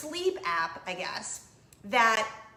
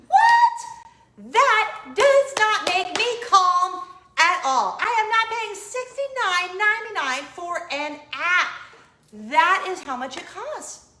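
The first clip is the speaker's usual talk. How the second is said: much louder and higher, indignant at the price; a loud, incredulous protest; outraged shout; 8 semitones higher, 10 dB louder, slower than usual. Real, not acted.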